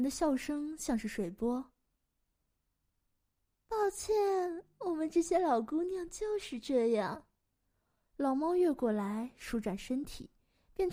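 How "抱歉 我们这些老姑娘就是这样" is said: put-on high, gracious old cat lady; high voice, settling slightly by the end; talking cat. Old cat apologises for witches.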